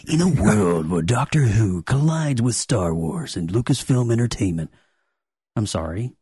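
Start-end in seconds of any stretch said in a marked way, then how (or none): 0.1-4.7 movie trailer voice. Pretend. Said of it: "sounds like a movie trailer".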